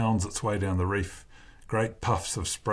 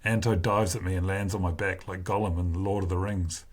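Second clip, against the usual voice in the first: ordinary delivery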